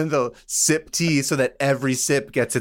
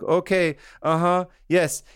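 high-pitched, placating phone voice; trying to hang up; 6 semitones higher than usual. Retold or imitated. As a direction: humour a talkative caller while edging towards goodbye. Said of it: "trying to hang up and Navi keeps talking".

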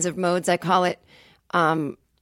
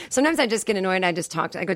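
usual speaking voice

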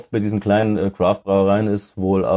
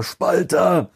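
mock shout; 9 semitones higher than usual. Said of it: "brüllen alle rüber".

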